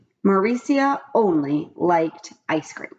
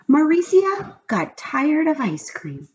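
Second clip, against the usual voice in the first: high, slow storybook reveal; dramatic reveal; 9 semitones higher, slower than usual. A narrator's reading. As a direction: land the terrible news slowly.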